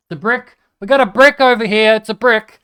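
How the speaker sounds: loud, high, thrilled yell; excited shout. Real, not acted. Gamer yelling over a loot drop, excited.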